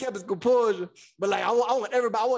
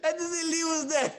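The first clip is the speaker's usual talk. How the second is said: ordinary delivery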